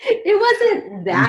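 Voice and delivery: drawn out through a real laugh; giggling denial. Real, not acted. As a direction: laugh the words out slowly. Laughing while denying story.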